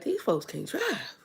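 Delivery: slow, hushed disgust; muttered complaint. Retold or imitated. Annoyed driver watching cars swerve, muttered quietly. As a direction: mutter it under your breath in disbelief. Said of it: "I'm like".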